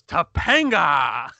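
drawn-out call. Retold or imitated. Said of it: "And he goes".

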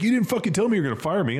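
a hurt, disbelieving squeal; high, quick retold complaint; mock outraged. Mike reacts to being fired.

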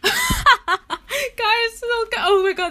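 high, loud laughing through the words; breathless laugh calling the viewers; scared laughter. Real, not acted. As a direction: laugh off a real fright. Laughing after a fright.

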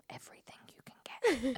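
unvoiced hush; greedy whisper. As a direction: whisper it like a secret deal. Psychic whispering greedy demand.

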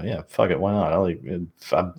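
ordinary talk

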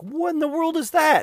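high squeaky disbelief; shocked at a screenshot of my own site; baffled surprise. Retold or imitated. Finding the wrong date on the mobile site, squeaky and baffled. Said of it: "And so I was like".

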